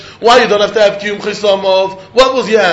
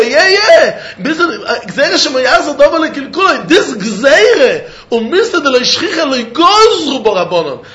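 laughing; 6 semitones higher than usual. Real, not acted. Teacher laughing through his point.

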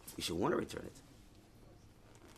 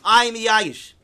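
top-of-the-lungs yell, much louder; 5 semitones higher, 10 dB louder, much slower than usual. Retold or imitated. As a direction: shout it out to everyone. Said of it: "On top of his lungs".

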